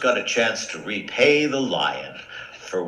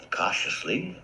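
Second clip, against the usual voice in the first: hushed narration; whispered; 3 semitones lower, 8 dB quieter than usual. A narrator's reading. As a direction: tiptoe through the word.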